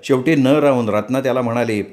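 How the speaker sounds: usual speaking voice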